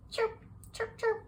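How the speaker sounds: high hushed chirp chirp; soft cricket chirping sound; whispered cricket chirps. Acted. Imitating crickets in the quiet night, whispered chirps.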